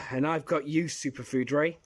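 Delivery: normal talking voice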